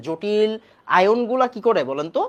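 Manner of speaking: ordinary talk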